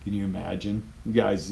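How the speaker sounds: normal talking voice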